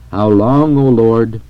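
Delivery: understated ecstatic appeal to God; fervent exclamation. A narrator's reading. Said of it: "exclaimed the holy king and prophet in his exceeding love".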